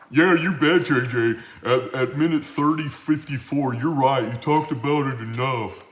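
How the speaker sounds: loud, hammed-up heckler voice; mock heckling viewer. Host imitates a viewer calling him out, loud mock heckling. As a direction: crow it loudly like a mock heckler.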